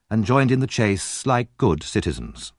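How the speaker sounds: ordinary delivery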